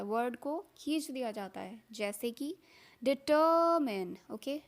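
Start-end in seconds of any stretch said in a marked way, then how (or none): 3.0-4.1 determine drawn out very slowly and louder; stretched British determine. Shown as a demonstration. Teacher exaggerates the drawn-out British determine.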